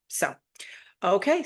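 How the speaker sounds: normal talking voice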